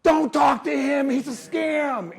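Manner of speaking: loud, slow, high warning shout; nosy neighbor yelling. Retold or imitated. Neighbor shouting to others about the salesman. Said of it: "they're like".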